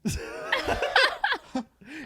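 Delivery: high burst of laughter; laughing hard at the joke; loud laugh. Real, not acted. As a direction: crack up laughing.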